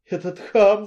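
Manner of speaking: loud, high outburst, words tumbling; choking with rage. A narrator's reading. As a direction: sputter it out in fury. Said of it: "захлёбывалась она".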